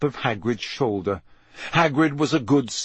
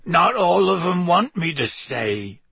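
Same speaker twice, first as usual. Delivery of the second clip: slow, hoarse half-giant voice; hoarse Hagrid, slow and hurt; 6 semitones higher than usual. Acted. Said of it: "said Hagrid hoarsely".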